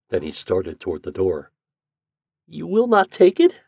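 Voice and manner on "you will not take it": much higher, louder pleading question; piteous plea. Acted. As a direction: ask with heartbreak. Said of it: "she asked piteously".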